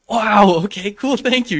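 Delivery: high, loud burst of amazed thanks; excited thanks. Retold or imitated. Thanking the future manager, high and amazed. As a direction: gush thanks in disbelief.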